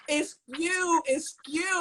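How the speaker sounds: mock Baltimore Excuse; trying a Baltimore accent on Excuse; Baltimore accent attempt. Shown as a demonstration. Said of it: "let me try to do the Baltimore".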